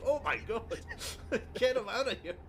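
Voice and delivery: high, loud hammed-up alarm; villain faking shock after the explosion; mock panic. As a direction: shriek it in fake horror. Mock panic after blowing up room.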